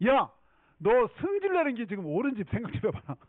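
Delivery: mildly hammed-up divine telling-off; acted rebuke. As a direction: call him out, then challenge him. Voicing God rebuking sulking Jonah.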